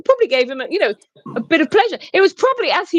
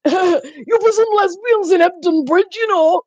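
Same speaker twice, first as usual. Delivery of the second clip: slow, higher northern English voice; northern aunt voice; 5 semitones higher, slower than usual. Acted. Imitating the northern aunt for Emma, northern accent. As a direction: put on a drawn-out northern aunt.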